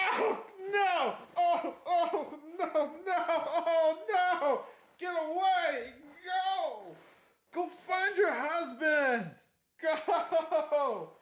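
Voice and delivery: high, hammed-up horrified wailing; comic high-pitched panic; mock panic. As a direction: cry out, desperately shooing someone away. Acts character fleeing wrong woman.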